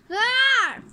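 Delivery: playful story-book roar. Acted. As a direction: roar out loud like a little monster.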